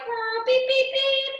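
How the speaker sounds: high, loud playful beeps; imitated beeping. Teacher showing noisy play to distract a crying child, playful beeping. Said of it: "we're like".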